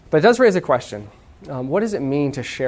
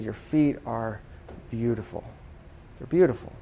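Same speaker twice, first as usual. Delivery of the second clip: hushed passion; 4 semitones lower, 10 dB quieter than usual. Retold or imitated. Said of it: "he had such passion".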